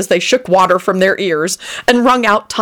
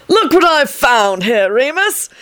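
put-on slow, higher old man croaking happily; happy croak; 7 semitones higher, slower than usual. A narrator's reading. Old man showing off his find to Remus, happy croak. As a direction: croak it out with glee. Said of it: "he croaked out happily".

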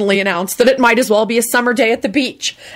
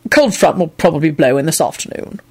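firm Quentin. A narrator's reading. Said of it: "told Solange firmly".

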